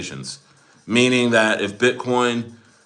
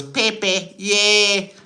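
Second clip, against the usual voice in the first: high, silly mock leader voice; Xi Jinping; 8 semitones higher, much slower than usual. Streamer imagines Chinese leader cheering for coin, high mock voice. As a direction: pipe it up in a badly faked impersonation. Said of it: "but he just goes".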